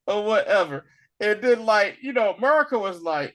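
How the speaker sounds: ordinary delivery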